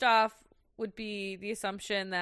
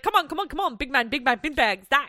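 loud, higher auctioneer patter; 6 semitones higher, 8 dB louder, faster than usual. Retold or imitated. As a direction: rattle it off like an auctioneer. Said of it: "he sounds like an auctioneer".

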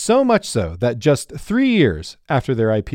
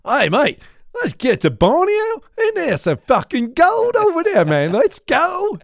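hammed-up Australian mate pushing the trip; cheeky Australian geologist pitching Borneo; Australian accent. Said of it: "my Australian accent comes out frequently".

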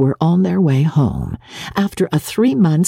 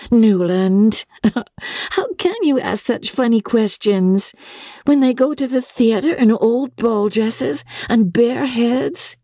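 put-on raised young woman's voice with a light laugh; May, amused; 9 semitones higher than usual. Young wife laughing at her husband's funny question. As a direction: tease him for a silly question.

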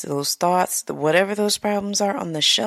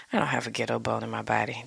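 muttered aside; 5 semitones lower, 7 dB quieter, much faster than usual. Real, not acted. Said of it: "did not sound the way I wanted".

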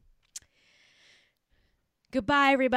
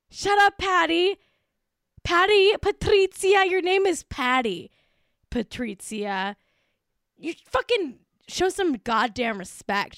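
mock outrage; 5 semitones higher than usual. Fan scolding the woman [name] plays, in mock outrage. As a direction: tell her off with playful indignation.

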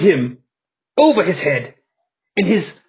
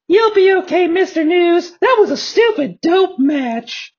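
much higher, sing-song wrestler voice; high flamboyant voice; 12 semitones higher than usual. Acted. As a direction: play a flamboyant showman. Johnny B. Bad comforts News.

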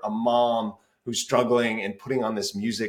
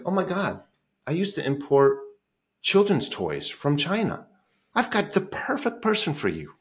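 excited woman; 7 semitones higher than usual. Retold or imitated. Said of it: "she's like".

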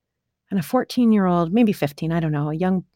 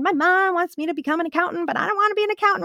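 put-on much higher complaining girl voice; higher mock teen complaint; 9 semitones higher, faster than usual. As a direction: imitate a whiny teenage girl. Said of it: "one of them said".

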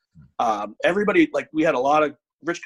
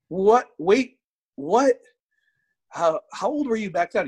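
higher, stunned double take; disbelief; 7 semitones higher, slower than usual. Retold or imitated. Learning the lifter was in high school, stunned. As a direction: ask it as if you misheard. Shocked at the guy's age.

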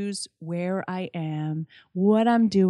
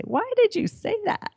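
much higher, mock-incredulous self-scolding; 14 semitones higher than usual. Guest mocking her own silly remarks, high incredulous squeal. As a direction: squeal it at yourself in disbelief.

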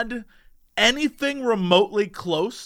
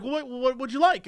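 higher, stumbling customer-service question; imitated barista; 6 semitones higher, 3 dB quieter than usual. Barista taking a newcomer's order, imitated puzzled voice. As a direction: lightly voice a puzzled woman at the counter.

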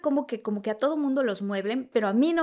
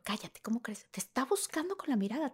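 whispered; 9 dB quieter than usual. Retold or imitated. Whispering back to her sister.

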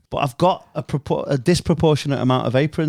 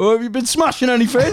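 chatty barber; 8 semitones higher, faster than usual. Retold or imitated. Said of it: "you're like".

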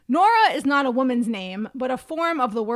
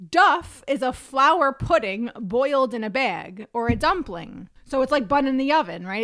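ordinary talk, nothing marked

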